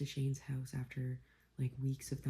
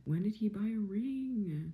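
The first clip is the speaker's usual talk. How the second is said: stunned disbelief; 7 semitones higher, slower than usual. Real, not acted. Higher, slower repeat of shock.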